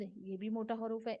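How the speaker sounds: ordinary talk, nothing marked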